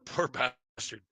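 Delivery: ordinary talk, nothing marked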